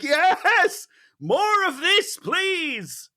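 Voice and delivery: high, elated mock cheer; excited cheer. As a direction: cheer him on, thrilled. Cheering at the troll's kill.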